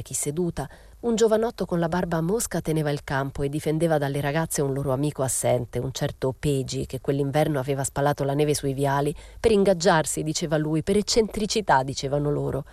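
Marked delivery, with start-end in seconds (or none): none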